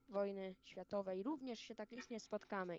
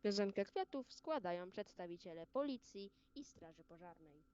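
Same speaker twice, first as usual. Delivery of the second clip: ceremonial announcement. Acted. Announces wreath laying ceremonially.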